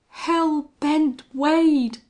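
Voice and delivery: slow, high, loud gasp of dread; terrified gasp. A narrator's reading. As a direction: gasp the name as if seeing death. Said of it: "gasped Folsom in terrible accents".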